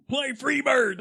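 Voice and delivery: high concert-crowd holler; high, hollered song request; heckler's shout. Acted. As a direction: shout the request from the back row. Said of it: "That's like the".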